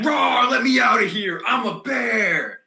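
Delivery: loud, high bellow as a trapped bear; roaring bear. Acted. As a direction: roar like an engine-bear fighting its chains. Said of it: "sounds like we got a giant bear".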